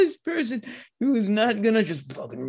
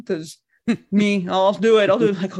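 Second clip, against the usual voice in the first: ordinary talk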